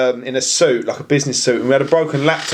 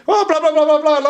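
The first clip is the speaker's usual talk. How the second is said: mock Arabic gibberish; 11 semitones higher than usual. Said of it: "he's speaking in Arabic".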